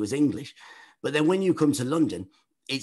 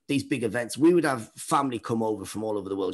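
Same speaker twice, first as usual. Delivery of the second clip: ordinary delivery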